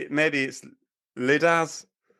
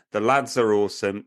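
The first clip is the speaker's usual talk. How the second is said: usual speaking voice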